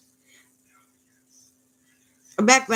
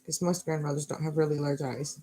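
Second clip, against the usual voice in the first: ordinary delivery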